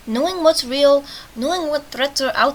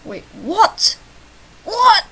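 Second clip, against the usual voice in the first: loud double take, second "what" much higher; startled disbelief; 9 semitones higher, 8 dB louder, much slower than usual. Real, not acted. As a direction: jump higher and louder in surprise. Startled reaction to game line.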